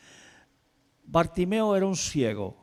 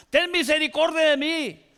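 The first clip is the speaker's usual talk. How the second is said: high, pleading call for mercy; pleading shout; 13 semitones higher, 3 dB louder than usual. Acted. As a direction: cry out above the noise. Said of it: "tuvo que gritar". (súplica gritada, aguda)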